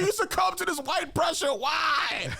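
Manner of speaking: high-pitched pleading why, shouted; high outraged cry. Retold or imitated. Fan wailing at a star who lost weight, shouting. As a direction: wail the whys like a betrayed fan.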